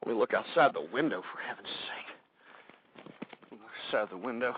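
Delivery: low, hushed, dropping into a whisper; furtive muttering. Pretend. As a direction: peek out nervously, keep it very quiet. Paranoid peek out the window.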